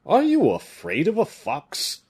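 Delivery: high, loud mocking question; scoffing. A narrator's reading. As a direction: laugh off the danger. Porthos scoffs at the fear.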